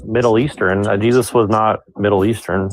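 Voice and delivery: normal talking voice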